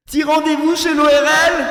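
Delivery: shout. Shown as a demonstration. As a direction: bellow it so they hear. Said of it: "t'as pas entendu. Attends".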